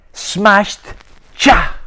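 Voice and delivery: loud punch on smashed, then a sharp sound; forceful smash. Acted. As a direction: slam the words down. Ben smashes the ice cream.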